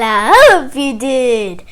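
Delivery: ordinary delivery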